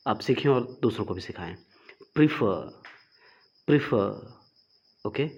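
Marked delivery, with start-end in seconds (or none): 2.1-2.8 प्रीफर said as "pri-fer", stress on the second syllable; correct pronunciation. Shown as a demonstration. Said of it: "इसका correct pronunciation है". 3.7-4.4 प्रीफर said as "pri-fer", stress on the second syllable; correct pronunciation. Shown as a demonstration. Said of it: "इसका correct pronunciation है".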